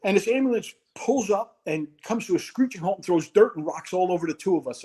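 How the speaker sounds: ordinary talk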